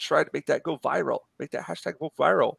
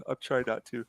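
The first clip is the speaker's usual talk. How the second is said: normal talking voice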